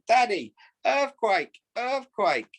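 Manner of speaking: put-on high, slow little girl teasing; gleeful child. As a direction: sing it out like a giggly toddler. Child copies dad's game gleefully.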